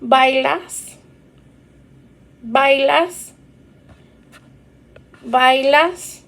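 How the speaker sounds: "¿Bailas?" rising at the end each time; teacher drilling the rise; rising question tune. Shown as a demonstration. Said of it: "use your intonation".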